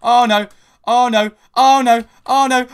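high, loud, repeated frantic cries; panicked shrieks. Real, not acted. Gamer shrieking as two teamers attack her, frantic panic. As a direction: shriek it as the enemies close in.

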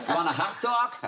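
laughing New Jersey accent; New Jersey twang with a laugh. Shown as a demonstration. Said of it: "I had to do a New Jersey accent".

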